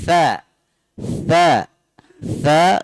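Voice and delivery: "tha" with the tongue tip touching the front teeth; tha demo. Shown as a demonstration. Teacher showing students the letter tha, crisp. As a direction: bite lightly on the "tha". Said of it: "ujung lidah bertemu dengan ujung gigi seri".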